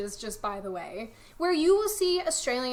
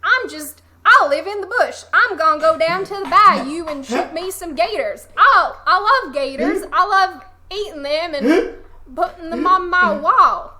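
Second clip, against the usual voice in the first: loud, higher-pitched fake American country accent; hammed-up country American hollering about gators; 5 semitones higher, 10 dB louder than usual. Mocking gun-loving Americans in a loud country accent. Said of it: "her accent seemed more of an American country accent".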